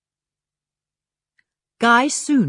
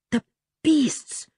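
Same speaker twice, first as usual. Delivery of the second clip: fervently; 7 semitones higher than usual. A narrator's reading. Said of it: "said Harry fervently".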